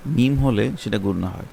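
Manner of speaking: ordinary delivery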